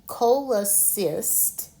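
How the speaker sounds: "cola cis" said slowly, each syllable pulled apart. Shown as a demonstration. Teacher sounding out a medical word for students, slowly by syllable. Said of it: "I'm going to break it into syllables".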